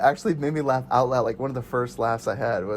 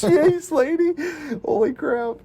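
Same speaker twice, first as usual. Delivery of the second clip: mock shocked; slower than usual. Retelling a film scene, mock shocked squeal. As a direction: squeal it like a stunned henchman.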